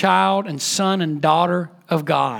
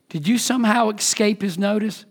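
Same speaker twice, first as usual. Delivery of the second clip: ordinary talk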